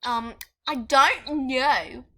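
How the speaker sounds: drawn-out fake British; slow, hammy mock-British delivery; bad British accent. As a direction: answer in a badly faked British accent. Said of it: "This is my British accent".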